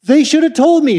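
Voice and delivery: high, loud indignant outburst; angry. Retold or imitated. Louisa protesting her church never told her, angry outburst. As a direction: throw the blame at them. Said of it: "She was angry".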